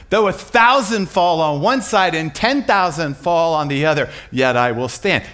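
forceful preacher's quotation; declaimed scripture. Retold or imitated. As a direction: proclaim the verse with conviction.

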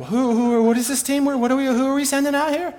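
high-pitched, mock-alarmed questioning; baffled official. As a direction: sputter questions in a high, bewildered voice. Mock baffled Joint Chiefs reaction.

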